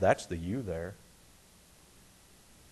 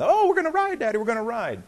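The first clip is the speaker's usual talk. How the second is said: put-on squealing, much higher girl's delight; excited little girl; 11 semitones higher, 3 dB louder, faster than usual. Little girl squeals to her daddy at the front of the line, high and excited. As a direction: play the thrilled little girl reaching the front.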